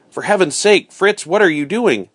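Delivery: high, mildly alarmed exclamation; alarmed cry. A narrator's reading. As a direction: demand to know, in storybook alarm. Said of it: "Alarmed now, I cried".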